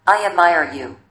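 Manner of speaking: plain reading voice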